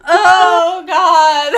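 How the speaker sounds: loud, high wail of dismay; hammed-up, near-tearful cry. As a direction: wail it over a lost game horse. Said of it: "Are you crying?".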